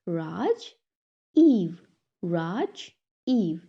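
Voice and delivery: "Raj Eve" as two separate beats; syllable by syllable. Shown as a demonstration. Teacher breaks a pupil's name, "Raj Eve" in two parts. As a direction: clear pause between "Raj" and "Eve". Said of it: "break this word into two syllable".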